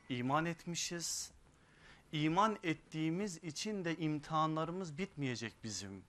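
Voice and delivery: high, loud, impassioned sermon voice; fiery preaching. Acted. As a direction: preach it out with rising force. Sermon rising to full force.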